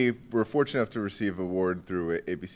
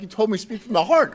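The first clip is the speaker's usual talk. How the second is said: higher, louder mock outrage; replaying the rant, pitched up and loud; retold tirade; 13 semitones higher, 8 dB louder than usual. Principal retelling his rant at the superintendent, mock outraged shout. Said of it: "I went into a tirade".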